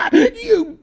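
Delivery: very high, loud laughing squeal; laughing demo. Shown as a demonstration. User demonstrating tickled laughter, high laughing squeal. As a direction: squeal the word through a put-on laugh.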